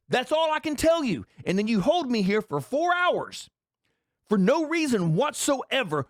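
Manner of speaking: exasperated rant back at the customs officer; retold blowup. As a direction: let the frustration boil over, pitch climbing. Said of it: "I lost my shit".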